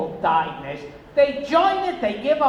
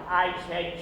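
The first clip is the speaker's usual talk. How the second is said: ordinary delivery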